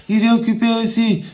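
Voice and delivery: put-on high little boy voice. Small boy telling caller everyone is busy, high child voice. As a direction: answer like a small child, matter-of-fact.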